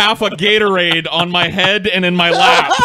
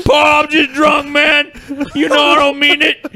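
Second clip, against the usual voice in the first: put-on drunk pleading shout; 9 semitones higher than usual. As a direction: shout it like a happy drunk apologizing. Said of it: "And he was like".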